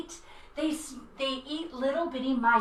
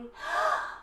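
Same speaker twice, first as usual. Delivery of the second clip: high delighted gasp; big happy gasp; excited gasp; 3 dB quieter than usual. Acted. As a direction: gasp with glee for the kids. Excited gasp after song.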